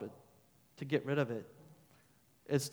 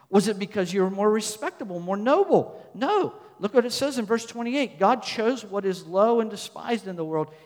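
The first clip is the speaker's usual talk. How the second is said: loud, raised-pitch sermon delivery; forceful preaching; 5 semitones higher, 8 dB louder than usual. Real, not acted. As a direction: drive the point home to the congregation.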